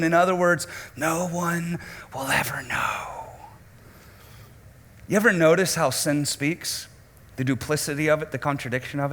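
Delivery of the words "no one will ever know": hushed whisper of a secret. Acted. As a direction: breathe it like a secret no one may hear. Sin speaks secretly.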